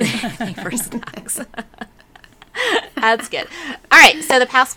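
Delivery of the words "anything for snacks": laughing. Real, not acted. Echoing the line with laughter.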